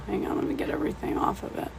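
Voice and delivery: very soft, quick aside to yourself; muttered aside. Real, not acted. Painting teacher to self while cleaning a dirty tool, muttered aside. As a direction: murmur it fast, almost without voice.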